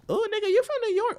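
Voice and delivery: high squealed disbelief; a shrill hammed-up gasp of surprise; mock shock. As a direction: act floored by where they're from. Mock shocked reaction to New Yorker.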